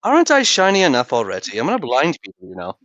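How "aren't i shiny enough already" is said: ordinary talk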